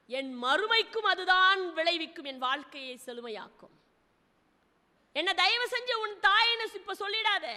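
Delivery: normal talking voice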